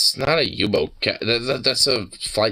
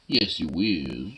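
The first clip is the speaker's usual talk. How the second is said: talking to a dog; 4 semitones lower, 6 dB quieter, much slower than usual. Real, not acted. Slower, falling-rising coo.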